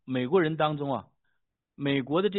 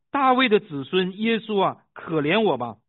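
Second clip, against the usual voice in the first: louder, higher plea within a reading; raised-voice narrated shout; crying out; 6 semitones higher, 10 dB louder than usual. A narrator's reading. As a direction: call out as the beggar begging for mercy. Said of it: "就喊着说".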